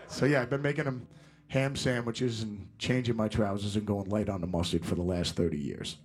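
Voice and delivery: ordinary delivery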